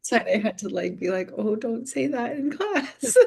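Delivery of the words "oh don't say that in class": mock-stern telling off, breaking into laughter; laughing through a scolding. Teacher scolding swearing boy in class, laughing through it. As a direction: try to scold but laugh through it, higher. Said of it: "dying laughing inside".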